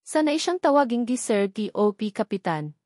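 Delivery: plain reading voice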